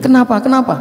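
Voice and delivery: a startled leader asking what's wrong. Retold or imitated. Leader startled by the crying wife, high and alarmed. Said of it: "amirnya kaget".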